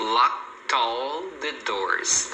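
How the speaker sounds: LOCKED ALL heard as "lock-tall"; slow demo, moving the T of "locked" onto "all"; "locked all the doors" as "lock-tall the doors"; linked words. Shown as a demonstration. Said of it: "você emenda com all".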